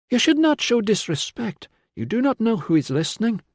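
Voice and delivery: high-pitched voice of warning; admonishing healer. A narrator's reading. Healer warning a soldier who jokes about ghosts, admonishing.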